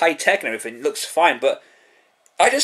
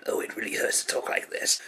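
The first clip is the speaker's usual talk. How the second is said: rasping unvoiced whisper, softer; Starscream whisper; 11 dB quieter than usual. Reviewer imitating Starscream's voice, raspy whisper. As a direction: rasp it out like Starscream. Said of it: "It doesn't hurt to talk like this".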